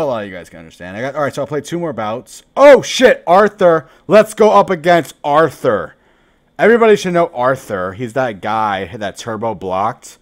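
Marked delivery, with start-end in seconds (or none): 2.6-7.9 high, loud eager callout; excited shout. Real, not acted. Streamer spotting a known opponent, loud excited callout. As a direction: jump on the chance to face him.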